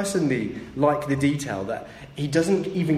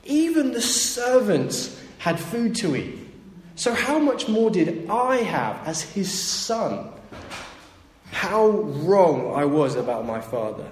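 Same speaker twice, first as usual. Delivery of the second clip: high, slow wondering voice; lightly voiced son in awe; son's realization; 5 semitones higher, slower than usual. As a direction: voice the son waking up to the truth, higher and slower. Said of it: "he goes".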